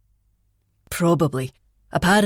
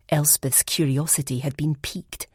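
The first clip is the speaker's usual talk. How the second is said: usual speaking voice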